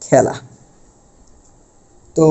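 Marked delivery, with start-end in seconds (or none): 0.0-0.3 খেলা with open æ, "khæla"; open-vowel demonstration. Shown as a demonstration. Teacher repeats the rule's example for students, খেলা with open æ. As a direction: model খেলা with the vowel of "cat". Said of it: "এরকম".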